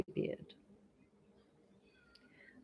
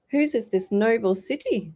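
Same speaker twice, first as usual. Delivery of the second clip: higher, louder question; haughty princess; 6 semitones higher, 8 dB louder than usual. A narrator's reading. Princess asks.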